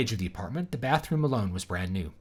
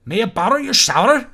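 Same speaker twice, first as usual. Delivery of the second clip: fake Irish accent; 11 semitones higher, 9 dB louder than usual. Shown as a demonstration. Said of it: "your fake Irish accent".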